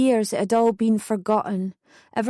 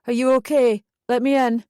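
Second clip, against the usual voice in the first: mildly raised, concerned call; shouted through door; 3 semitones higher, 4 dB louder than usual. A narrator's reading. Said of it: "shouted Neil".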